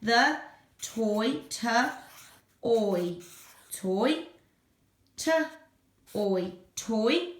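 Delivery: t and oi segmented slowly, then blended into toy; teacher segmenting t, oi, then blending toy; sounding out toy. Shown as a demonstration. Teacher models segmenting toy for parents. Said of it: "teacher sounds out the word".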